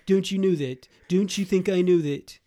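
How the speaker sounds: dopey British royal voice; a dopey young Vincent Price, English vowels; Charles. Acted. Dad shows how Charles talks, dopey royal voice. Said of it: "He's like a twenty-four-year-old Vincent Price".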